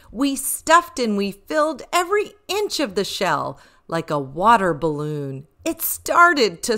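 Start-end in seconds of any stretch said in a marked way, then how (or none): none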